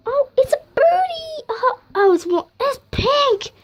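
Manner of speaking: high, squealing with delight; excited squeal. Real, not acted. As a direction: squeal over the pink birdie. Finds the toy bird, squealing.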